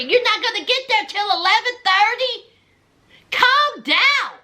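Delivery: high-pitched mock scolding mother; exasperated scolding. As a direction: imitate a mother impatiently setting someone straight. Mother scolding on phone, acted.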